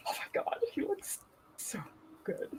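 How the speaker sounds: whispered, swooning over him; awed whisper about the actor; breathless whisper. Real, not acted. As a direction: whisper it like a crush.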